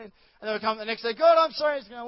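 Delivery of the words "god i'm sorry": higher and louder, hammed-up plea; mock pleading apology. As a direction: cry out the apology. Believer voiced apologizing again.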